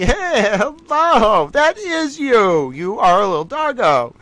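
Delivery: high cooing voice to a pet. Real, not acted. Talking to the dog on screen.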